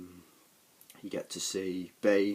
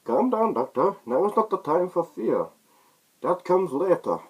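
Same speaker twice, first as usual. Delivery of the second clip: much higher, loud, muffled menacing villain; Bane voice; 11 semitones higher, 9 dB louder than usual. Retold or imitated. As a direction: loom over the doctor like the masked villain. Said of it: "Bane just says".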